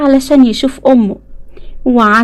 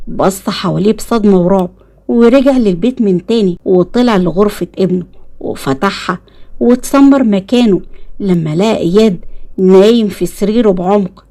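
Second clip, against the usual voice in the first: usual speaking voice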